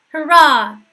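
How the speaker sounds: high, loud crowd hurrah; shouted cheer. A narrator's reading. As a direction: cheer out for the new king. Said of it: "threw up their caps and shouted".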